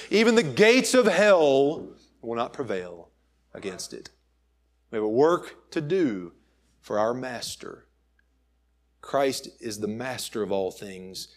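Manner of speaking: ordinary delivery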